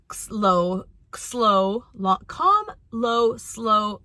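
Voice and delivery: slow, drawn-out deliberate words; calm, slow voice. Shown as a demonstration. Mother describing how she talks to her children, calm and slow. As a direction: demonstrate slowness, stretching each word. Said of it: "used to me talking in a calm".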